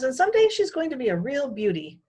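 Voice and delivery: fond, admiring mother. A narrator's reading. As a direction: gush about her like a proud mom. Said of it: "my mother says".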